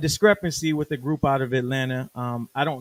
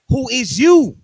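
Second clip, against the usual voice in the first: louder, higher threatening demand; menacing rapper; 8 semitones higher, 11 dB louder than usual. Retold or imitated. Rapper pressing the engineer again: a louder threat. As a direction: bark it at the intruder.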